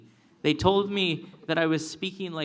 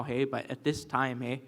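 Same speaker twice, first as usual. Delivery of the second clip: ordinary delivery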